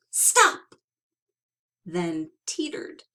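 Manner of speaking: plain reading voice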